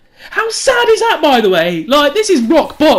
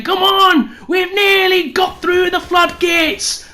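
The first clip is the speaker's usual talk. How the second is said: shouted battering ram voice; 8 semitones higher, slower than usual. Retold or imitated. Storyteller voicing his bowels as a shouting battering ram. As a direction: yell it like a gleeful siege crew. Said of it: "like somebody was holding a battering ram".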